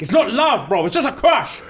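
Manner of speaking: high, mock-frantic insistence; shaking panic. Retelling Connor's friend calming him, high and frantic. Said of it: "he was shaking, fam".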